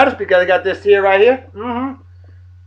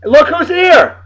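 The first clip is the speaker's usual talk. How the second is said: father welcoming daughter, excited shout; excited greeting; 8 semitones higher, slower than usual. Real, not acted. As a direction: announce her arrival happily. Greeting surprise entrance.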